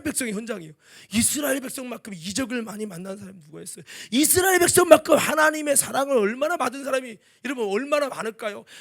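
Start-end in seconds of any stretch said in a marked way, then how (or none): 4.1-8.7 loud, rising preacher's cry; preaching shout. Real, not acted. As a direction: call it out to the congregation. Preaching climax.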